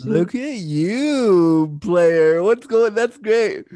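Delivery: teasing the guest about the piano man, excited; playful teasing. Real, not acted. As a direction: hype up a friend as a player.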